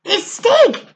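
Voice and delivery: high, loud excited cry; excited near shout. Acted. Barney spotting his friend below, excited near shout. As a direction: blurt it out in delight. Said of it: "he almost shouted".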